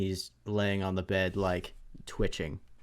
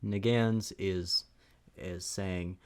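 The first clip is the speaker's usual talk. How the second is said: ordinary talk, nothing marked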